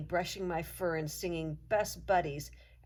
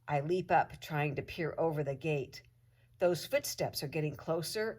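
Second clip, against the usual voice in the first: plain reading voice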